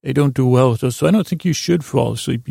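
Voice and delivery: ordinary talk, nothing marked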